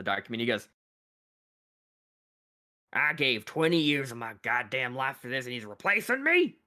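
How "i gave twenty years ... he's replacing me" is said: bitter outrage. Acted. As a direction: spit out the betrayal, climbing in pitch. Minotaur learns he's replaced.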